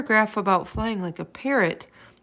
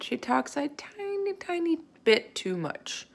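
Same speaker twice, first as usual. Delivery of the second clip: slow, high teasing drawl; drawn out; 10 semitones higher, slower than usual. A narrator's reading. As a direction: stretch out tiny tiny. Narrator teasing, drawn out slowly.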